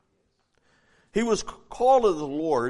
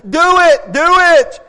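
loud, insistent do it, do it; rising loud urging; urging shout; 9 semitones higher, 13 dB louder, slower than usual. Real, not acted. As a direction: push them to act now. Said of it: "urging the congregation".

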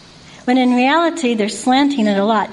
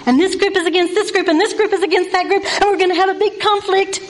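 faster, higher, stirred-up rattle; worked-up mimicry; 6 semitones higher than usual. Speaker mimics reporters stirring up conflict, worked up. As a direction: rattle it off higher, like stoking a feud.

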